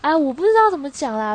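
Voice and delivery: exasperated sigh. Real, not acted. Exasperated at her own confusion.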